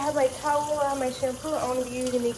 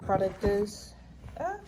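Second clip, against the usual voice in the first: ordinary talk, nothing marked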